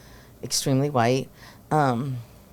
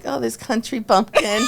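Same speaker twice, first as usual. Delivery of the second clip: higher cooing over a bumpkin; mock cooing; 9 semitones higher, 4 dB louder than usual. Coach imitating herself teasing the new girl. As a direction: tease her like a sweet country kid. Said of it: "so I'm like".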